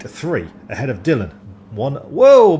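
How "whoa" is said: sudden loud startled whoa. Real, not acted. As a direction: jump at the slide, loud. Car slides, commentator startled.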